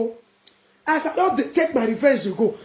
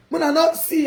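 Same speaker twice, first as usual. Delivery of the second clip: normal talking voice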